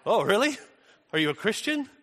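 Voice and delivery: high-pitched questioning, mock astonishment; retold surprise. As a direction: ask it high, imitating pleasant disbelief. Retold surprised reaction to stranger.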